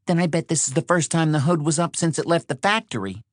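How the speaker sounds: high, quick woman's character voice; exasperated, fast and high; accusing Patty. Acted. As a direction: rattle off the jab, pitched high. Voices Patty needling Shorty.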